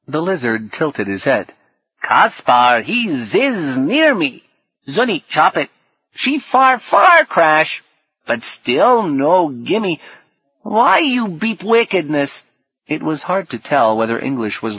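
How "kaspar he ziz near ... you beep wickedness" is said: high, drawn-out swooping creature voice; lizard's kid speak. Acted. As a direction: squawk garbled kid slang as a little lizard. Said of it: "so sunk in kid speak as to be almost unintelligible".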